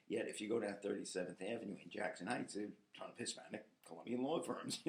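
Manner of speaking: usual speaking voice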